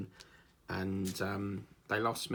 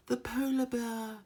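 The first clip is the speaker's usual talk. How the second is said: "polar bear" jumps much higher, slow and drawn out; documentary narrator; 14 semitones higher than usual. Retold or imitated. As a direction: sing "The polar bear" up high like an awed presenter. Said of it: "the one there he goes".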